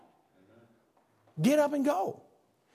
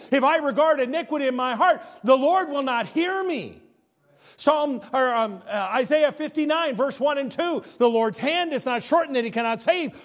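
rising, louder preacher's cadence, scripture driving home; full-force preaching; 4 semitones higher, 4 dB louder than usual. Acted. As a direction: preach it to the back pew.

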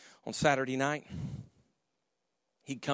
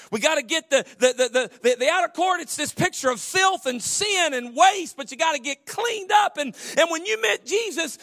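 shouted sermon line; 13 semitones higher, 9 dB louder than usual. Acted. Preaching at full force.

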